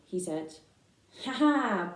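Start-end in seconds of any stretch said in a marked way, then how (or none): 1.3-1.9 higher, mocking lamb laugh; bleating laugh. A big lamb mocks Babe, bleating ha ha. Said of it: "bleated a big lamb".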